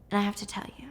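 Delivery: hushed and breathy, barely voiced; whispered confession. Confessing to her boyfriend, in a hushed whisper. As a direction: let it slip out in a whisper.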